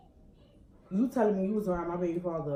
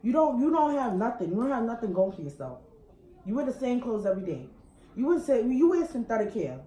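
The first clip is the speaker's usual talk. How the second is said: angry rant; 5 semitones higher, 6 dB louder than usual. Real, not acted. Woman yelling at her partner's side girl, furious and loud.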